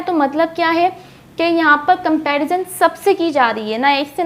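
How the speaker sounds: normal talking voice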